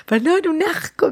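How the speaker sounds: laughing through the words, pitched high; laughing while recalling the defect notebook. Real, not acted. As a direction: giggle while telling it. Laughing about friends' notebook.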